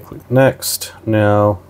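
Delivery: ordinary talk, nothing marked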